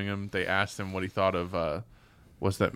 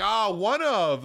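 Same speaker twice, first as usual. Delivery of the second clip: mocking jeer; 11 semitones higher, 4 dB louder, much slower than usual. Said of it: "They're like".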